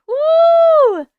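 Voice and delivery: loud high whoop; excited woo; cheer. Real, not acted. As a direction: whoop for the new members. Cheering new members on live.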